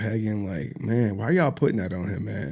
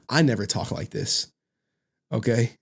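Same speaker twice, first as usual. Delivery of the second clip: ordinary talk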